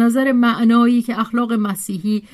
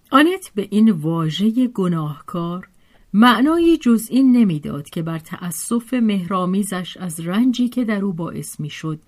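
plain reading voice

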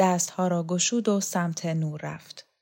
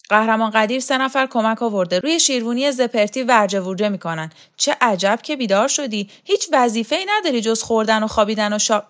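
loud, higher, fast-running tirade; bossy scolding woman; 4 semitones higher, 6 dB louder, faster than usual. Acted. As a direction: berate a lazy underling at full tilt.

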